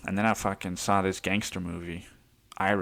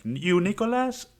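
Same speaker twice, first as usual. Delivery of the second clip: much higher put-on driver voice, asking; imitating the driver; 12 semitones higher than usual. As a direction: squeak out the question like the odd driver. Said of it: "He's like".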